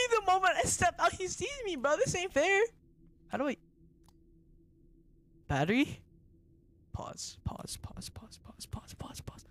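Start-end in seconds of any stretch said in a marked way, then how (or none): 0.1-2.6 high-pitched wailing complaint; distressed whining. Real, not acted. Caught on stepping out, protests. 6.9-9.4 very soft, repeated whisper; urgent whisper. Real, not acted. Urgently trying to pause game.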